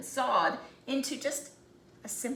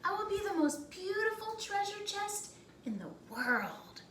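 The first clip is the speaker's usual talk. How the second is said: put-on higher, airy voice of a hopeful young tree; dreamy little tree; 8 semitones higher, 4 dB quieter than usual. First tree telling the others its wish, higher and dreamy.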